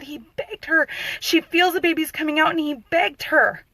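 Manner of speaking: high, urgent retold protest; mild imitation of screaming, upset. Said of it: "I was just screaming".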